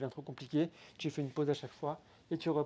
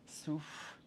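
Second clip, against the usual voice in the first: soft breathing cue; 8 dB quieter, much slower than usual. Shown as a demonstration. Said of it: "souffle bien quand tu montes".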